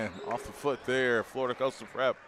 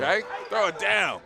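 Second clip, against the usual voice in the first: raised, excited shout of approval; hyped dunk call; 7 semitones higher, 4 dB louder than usual. Real, not acted. Commentator urges the big fella to throw it down, excited call. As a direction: cheer the dunk as it happens.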